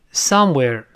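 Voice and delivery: "somewhere" with strong SOME, falling on "where"; stress on first syllable. Shown as a demonstration. Teacher has learners read aloud, "somewhere" stressed on "some". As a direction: hit the "some" in "somewhere", let it fall. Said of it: "读成，大声读一遍".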